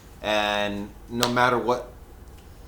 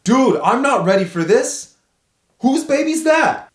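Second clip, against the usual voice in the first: stunned panic; 12 semitones higher, 4 dB louder than usual. Retold or imitated. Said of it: "I remember going".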